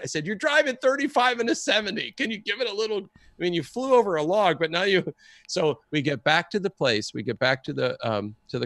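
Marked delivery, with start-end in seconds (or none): 0.3-5.0 exasperated retold complaint. Acting out exasperated complaint to driver.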